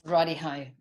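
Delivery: ordinary delivery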